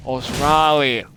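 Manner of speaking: slow, drawn-out Aussie "Australia", high and loud; Australian accent. Acted. Streamer parodying Aussies to the chat, exaggerated Australian accent. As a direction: stretch it out in a hammed-up Australian accent. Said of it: "Or other Australian terms".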